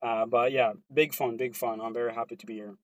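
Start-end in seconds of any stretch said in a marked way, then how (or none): none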